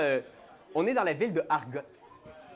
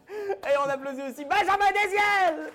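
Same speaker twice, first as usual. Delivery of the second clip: excited; 10 semitones higher than usual. Real, not acted. Host hyping a guest player.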